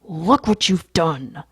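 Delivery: slow, forceful, desperate reproach; desperate rebuke. A narrator's reading. Narrator scolds sprawled Lewis, desperately. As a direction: press each word out in desperation. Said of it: "I whisper desperately".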